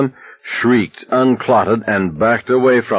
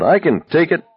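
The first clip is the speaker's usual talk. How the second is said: bellowing; 11 semitones higher than usual. A narrator's reading. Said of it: "Abraham bellowed".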